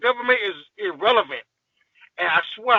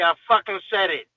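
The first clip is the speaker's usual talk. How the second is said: ordinary talk, nothing marked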